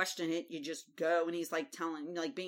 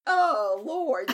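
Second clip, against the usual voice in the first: exasperated delight; 6 semitones higher, 8 dB louder than usual. Real, not acted. Reacting to Jon twirling Tyrion.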